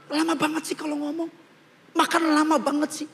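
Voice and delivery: mock exasperated grown child; impatient complaint. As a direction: grumble with high-pitched impatience. Impatient child scolding slow parent.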